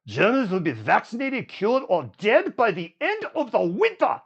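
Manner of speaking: hammed-up German accent, pitch climbing. Quoting the German health minister to TikTok viewers, mock German accent. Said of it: "in his German accent".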